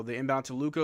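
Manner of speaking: brisk live game call; play-by-play. Acted. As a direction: call the action as it happens. Play-by-play continues.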